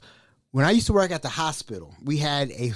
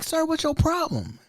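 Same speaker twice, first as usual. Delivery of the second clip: 1 exasperated; 12 semitones higher than usual. Retold or imitated. Speaker confronts screaming patient.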